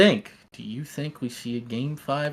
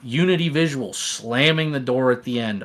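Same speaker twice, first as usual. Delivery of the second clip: ordinary delivery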